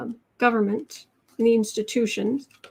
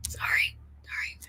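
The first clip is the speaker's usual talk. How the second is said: whispered sorry; 10 dB quieter than usual. Real, not acted. Apologising quietly to pet.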